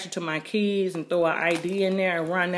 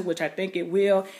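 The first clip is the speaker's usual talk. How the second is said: ordinary delivery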